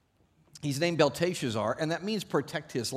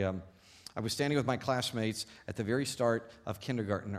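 ordinary talk, nothing marked

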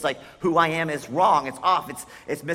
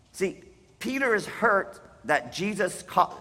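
ordinary delivery

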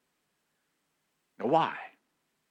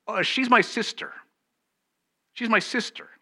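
high, anxious voice for a scared Isaac; Isaac caught off guard, lying to the men, high and nervous; nervous lie; 11 semitones higher, 7 dB louder than usual. Retold or imitated. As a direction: blurt the lie nervously, pitched up.

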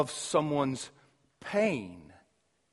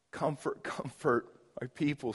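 much softer, with long pauses before it; tender and soft; 2 semitones lower, 8 dB quieter than usual. Shown as a demonstration. Preacher voicing God's comfort to his people, tender and soft. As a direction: ease into "Comfort, comfort" gently, as if soothing someone.

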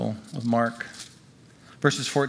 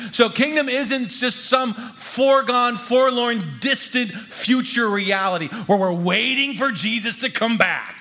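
high, emphatic pulpit delivery; forceful preaching; 10 semitones higher, 4 dB louder than usual. Real, not acted. Preacher telling church the kingdom isn't distant, forceful preaching. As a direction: hammer each descriptive word, then drive on.

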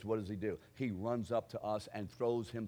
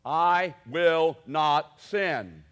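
"I will not sin" very slow and much louder, each word planted; slow firm declaration; 4 semitones higher, 13 dB louder, much slower than usual. Shown as a demonstration. Preacher demonstrating the vow to the congregation, slow and loud. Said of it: "here's what you say".

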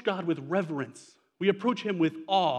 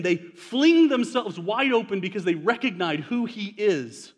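usual speaking voice

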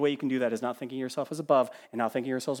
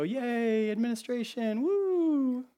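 higher, hollow mock cheer; sarcastic cheer; 9 semitones higher, much slower than usual. Preacher mocking a churchgoer given administration, sarcastic cheer. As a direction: fake enthusiasm for a dull gift. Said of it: "And you go".

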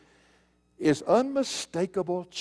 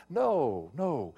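normal talking voice

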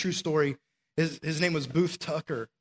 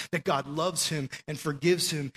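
ordinary delivery

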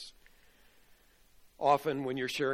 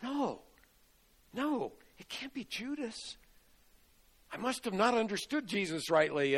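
stunned disbelief; 9 semitones higher than usual. Retold or imitated.

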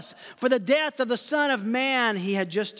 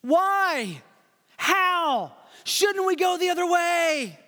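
pleading disciples; 7 semitones higher, slower than usual. Pretend. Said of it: "You want them to say".

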